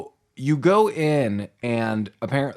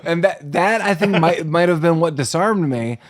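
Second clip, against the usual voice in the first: normal talking voice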